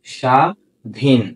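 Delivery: slow teaching demo of স্বাধীন; drawn out slowly. Shown as a demonstration. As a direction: stretch স্বাধীন slowly, stopping on the final ন. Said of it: "থেমে যাচ্ছি".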